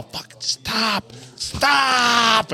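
high, drawn-out mock scream of STOP; retold yell. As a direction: stretch it into a strained high yell, held back in volume. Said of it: "I just yell".

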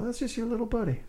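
imitating Jeff Bridges reassuring Bill, matter-of-fact. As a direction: toss it off lightly, like it's no big deal. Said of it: "said it so matter-of-factly".

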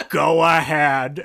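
very slow, loud cue to the crowd; imitated movie-star catchphrase. Retelling the actor cueing the audience, slow loud impression. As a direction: stretch it out as the star setting up his line. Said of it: "And he goes".

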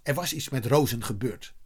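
ordinary delivery

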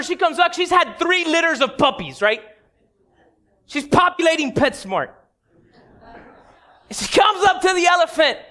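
normal talking voice

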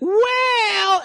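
put-on high, stretched-out child voice; imitated kid. Host as kid about to name toy, high mock voice. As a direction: draw it out high, leading into a wish. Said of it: "'cause he's like".

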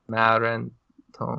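"Maren", pause, "Tom", repeated after the guest; careful pronunciation attempt. Shown as a demonstration. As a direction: try out "Maren Tom" word by word. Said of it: "how do you pronounce your surname".

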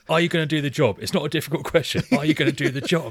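acted bullying interrogation; aggressive recited demand. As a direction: hammer the question without faltering. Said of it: "it's so aggressive".

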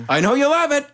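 high, sing-out voice to a buddy; teasing, high-pitched imitation; mock doting helper. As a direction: coo it as an imitated doting pal. Continues voicing the doting sauce pusher.

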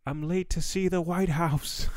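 high, slow, put-on panicked voice; panicked runner. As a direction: play a frantic guy running down the avenue. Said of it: "being like".

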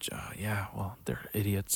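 soft unvoiced aside; whispered inner reply. Student answering the teacher in his head, whispered. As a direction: whisper it to yourself.

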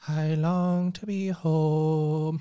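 put-on slow, wistful line in a light Irish accent. Host longs for Dublin pints, mock Irish accent. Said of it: "So you was doing an Irish accent then".

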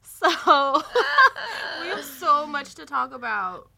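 laughing through the words; can barely get it out for laughing. Real, not acted. After vagina joke, cracking up.

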